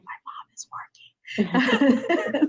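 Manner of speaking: slow, voiceless imitated whisper. Son behind his closed door while mom works, imitated whispering. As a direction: whisper it like a kid warning friends. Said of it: "you'll hear him whispering".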